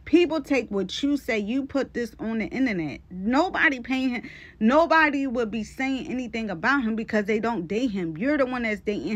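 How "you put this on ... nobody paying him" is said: usual speaking voice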